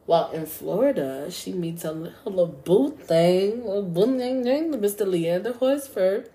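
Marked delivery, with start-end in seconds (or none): 1.9-6.3 playful sing-out, louder and higher as it goes; playful teasing. Real, not acted. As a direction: tease the sweetheart's introduction, drawing out "boo thing". Playfully introducing Tamla's husband.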